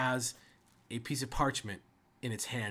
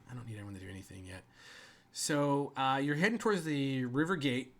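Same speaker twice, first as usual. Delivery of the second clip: normal talking voice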